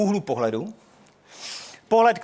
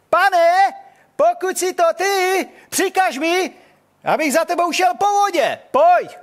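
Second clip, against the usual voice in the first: high, loud, slow pleading call; hammed-up eager call; 11 semitones higher, 5 dB louder, slower than usual. Peter calls from the boat to Jesus, loud and pleading. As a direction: shout it across the water, overeager.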